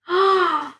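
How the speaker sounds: sharp, high gasp of surprise; a quick shocked gasp. A narrator's reading. Amber realising she can read minds, gasping high. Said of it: "Amber gasped".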